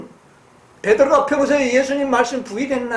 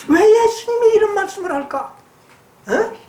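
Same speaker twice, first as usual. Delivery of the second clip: higher, bewildered wondering; puzzled disciple; 7 semitones higher than usual. Retold or imitated. Preacher voicing a disciple who is shocked by Jesus' words, higher and bewildered. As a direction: voice the disciples' confusion as a question.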